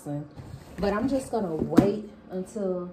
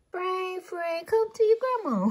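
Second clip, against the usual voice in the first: doting grandma coax; 9 semitones higher than usual. Real, not acted. Grandma coaxing her pet Frank to come, high and cooing. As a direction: sweet-talk him over in a high voice.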